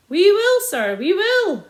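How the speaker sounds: loud then slow placating reply; soothing answer. A narrator's reading. Sergeant mock-placating the landowner, slowing down. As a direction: humour him sweetly. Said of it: "answered Sergeant Samways".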